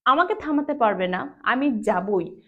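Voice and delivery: আমি যাবই said firmly with weight on আমি; emphatic insisting. Shown as a demonstration. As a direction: insist nobody can stop you. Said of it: "emphatic করে বললাম, জোর করে বললাম".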